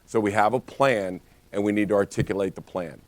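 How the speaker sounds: normal talking voice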